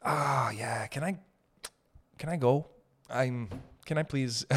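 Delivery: quiet, awkward begging to go; soft pleading exit. Retold or imitated. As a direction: ask sheepishly to leave. Begging to leave a conversation.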